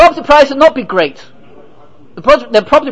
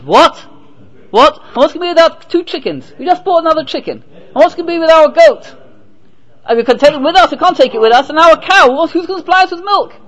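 higher, fussing wife voice; hint of a worried wife; 7 semitones higher than usual. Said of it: "His wife says".

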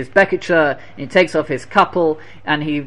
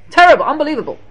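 higher and louder, outraged cry; outraged exclamation; 10 semitones higher, 6 dB louder, much faster than usual. Real, not acted. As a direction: call out the horror. Preacher decries the torture.

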